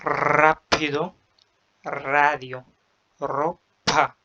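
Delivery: rolled r at the start of رابيدو, راديو, روبا; trilled r. Shown as a demonstration. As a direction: trill each initial r, slowly. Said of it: "بتتنطق".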